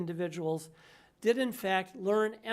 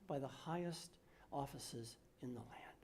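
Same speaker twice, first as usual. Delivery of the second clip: whispered warning; 6 semitones lower, 15 dB quieter than usual. Retold or imitated. Quoting Oliner's grave warning.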